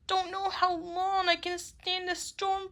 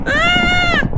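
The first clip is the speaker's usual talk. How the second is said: high, loud scream of fear; loud frightened shriek; 10 semitones higher, 14 dB louder than usual. Acted. Nina jumps at the thunder, shrieking. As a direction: shriek at the crash.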